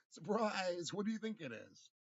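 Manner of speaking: loud, high sarcastic yell; mock surprise shout. Taunting an imagined attacker with a loud, high sarcastic yell. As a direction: shout it as a fake party greeting. Mocking imagined flashbang thrower.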